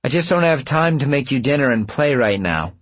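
high, loud exasperated brush-off; harried and fed up; impatient, stressed. A narrator's reading. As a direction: snap impatiently, pitch up. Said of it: "to be impatient and stressed".

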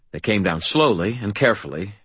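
ordinary delivery, nothing marked

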